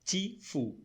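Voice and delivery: ti and fu set apart; tifu in syllables. Shown as a demonstration. Teacher counting syllables for students, tifu split in two. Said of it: "são três sílabas".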